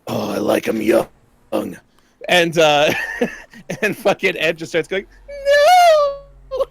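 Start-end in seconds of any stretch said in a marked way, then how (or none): 0.1-1.1 whispery, leering creep voice; creepy pervert. Retold or imitated. Retelling coworker's prank recording, whispered creepy pervert voice. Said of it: "like, some pervert". 5.3-6.7 very high, loud mock wail; yelling little kid. Imitating coworker playing the kid in prank recording, high yell. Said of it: "yelling, right?".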